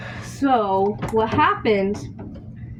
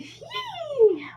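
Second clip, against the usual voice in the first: high squeak; 4 dB quieter than usual. Real, not acted. Excited squeak.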